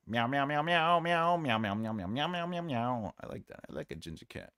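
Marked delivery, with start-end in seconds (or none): 0.1-3.1 playful string of cat meows. Pretend. Singing about a ginger cat, playful meowing. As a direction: meow along like a ginger kitten.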